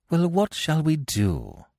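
slow, mildly exasperated question; a touch fed up, drawn out. A narrator's reading. Algernon pressing Jack, mildly exasperated. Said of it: "asked Algernon, exasperated".

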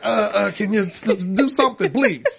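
a hint of Bill Cosby, badly faked; horrible pastor impression. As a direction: stammer out a half-laughing imitation of a pastor. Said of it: "It's a horrible impression".

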